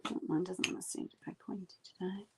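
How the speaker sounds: quick whispered mutter to herself; whispered aside. Real, not acted. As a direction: breathe it out, fast and under the breath. Muttered aside about the brush.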